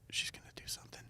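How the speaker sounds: hushed, anxious whisper to the table; worried whisper. Retold or imitated. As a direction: whisper it like a nervous mom afraid of a scene. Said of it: "'Cause she was like".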